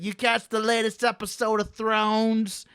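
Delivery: put-on high, slow Boston accent; badly faked old lady from Boston. Imagining an old lady chatting about TV, bad Boston accent.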